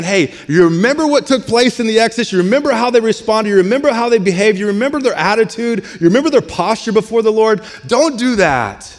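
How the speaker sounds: high-pitched, driving, insistent preaching; urgent exhortation. Retold or imitated. Paul warns the church about Israel's past, exhorting urgently. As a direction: hammer each reminder home, pitch held high.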